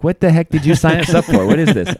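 loud, incredulous what-did-you-get-us-into; exasperated wife. Retold or imitated. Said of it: "giving me this look like".

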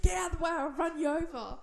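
loud angry threat; angry yell. Retold or imitated. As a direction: snap it out like a road-rager. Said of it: "I was like".

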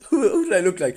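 laughing through the question. Real, not acted. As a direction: crack up mid-sentence. Laughing at looking homeless.

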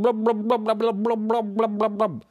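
high, loud gurgling blub sounds; talking underwater. Acted. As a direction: babble like a cat stuck underwater. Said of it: "the cat in the hat was trying to talk".

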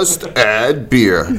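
slow, drawn-out radio announcer voice. Acted. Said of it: "sick of the radio announcer voice".

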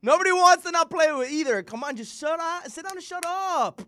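exasperated shout. Real, not acted. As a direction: tell him to sit down, fed up. Exasperated rant at Kyrie.

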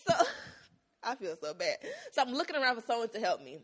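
ordinary talk, nothing marked

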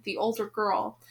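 ordinary delivery

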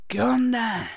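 a hint of a streetwise thug greeting.

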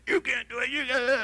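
mock gym yell. Preacher imitates weightlifters yelling taunts at each other. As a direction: bark it like a spotter goading his partner. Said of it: "they're yelling at each other".

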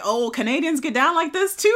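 loud, high-pitched mock astonishment; amazed reaction. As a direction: act out the surprise, big and high. Acts out surprise at reading headline.

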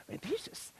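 real hushed laugh, breathed out; real rueful laughing whisper. Real, not acted. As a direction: whisper through a laugh at himself. Laughing, caught by the verse.

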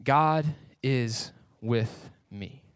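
very slow, soft and weighty; slow and solemn. Shown as a demonstration. A preacher presents a powerful phrase to the church, slow and hushed. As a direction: let "God is with me." sink in, drawn out word by word. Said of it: "one of the most powerful phrases".

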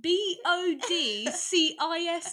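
mock repeat. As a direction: spell it back teasingly. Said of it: "I said P".

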